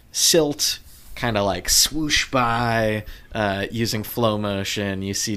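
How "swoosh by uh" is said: ordinary talk, nothing marked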